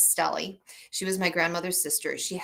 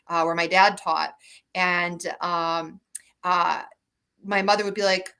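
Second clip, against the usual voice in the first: usual speaking voice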